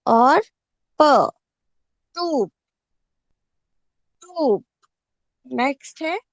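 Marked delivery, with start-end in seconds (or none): 0.1-4.7 टूप split into ट and प, each said loudly; spelling out sounds. Shown as a demonstration. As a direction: break टूप into its sounds for the class. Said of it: "ट को छोटी ऊ की मात्रा". (टूप को ट और प में तोड़कर बोलना)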